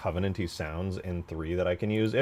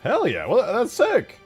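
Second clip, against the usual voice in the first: loud, high-pitched hyped reaction; real excited exclamation; 10 dB louder, slower than usual. Real, not acted. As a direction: burst out loud in delighted surprise. Reacting to a surprisingly perfect sound.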